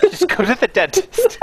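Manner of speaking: high laughing plea. Real, not acted. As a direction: laugh as you say it.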